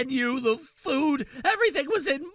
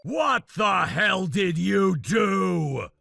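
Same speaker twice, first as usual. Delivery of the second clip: low, slow angry yell; furious shout; 7 semitones lower, slower than usual. Acted. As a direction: demand it in a rage. Furious groom confronts her.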